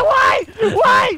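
high, pleading mock wail; mock distressed cry. Santa protesting being punched, mock distress. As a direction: cry out as if under attack.

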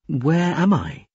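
slow, high-pitched wondering; drawn-out, mildly bewildered question; puzzled question. A narrator's reading. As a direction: ask slowly, unsure of your surroundings. Newcomer asks where she is.

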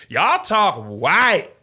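drawn-out, teasing cousins; slow, loud mock accusation; slow mocking drawl. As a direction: stretch every word like a jeering cousin. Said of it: "the first summer we was in Atlanta".

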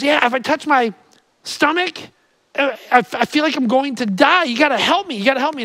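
high, pleading mock panic; anxious patient. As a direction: beg the doctor, hammed up.

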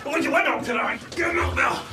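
fed-up outburst; exasperated shouting. Acted. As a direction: explode at the machine. Losing temper at the lift.

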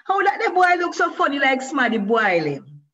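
high, loud schoolyard taunt in Jamaican patois; child's shout. A narrator's reading. Boy shouting a mocking question at Moshe, loud and high. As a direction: yell the jeer across the playground. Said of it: "the boy shouted his question".

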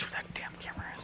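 hushed whisper while sneaking. Real, not acted. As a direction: whisper it so the enemy can't hear. Sneaking up, whispering near enemies.

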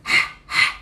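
short voiceless huffs; cleaning-glasses breath. Shown as a demonstration. Teacher quizzing students with cleaning glasses huffs. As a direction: huff as if fogging glasses to clean. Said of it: "Cleaning glasses".

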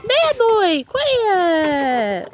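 very high, slow sing-song pet voice. Real, not acted. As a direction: coo at the whining dog, stretched out. Scolding neighbor's whining dog.

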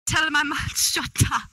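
clumsy imitation of Manchester British; unsure, badly faked British accent; attempted British accent. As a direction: have a go at copying a British accent. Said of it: "Oh, in a British accent".

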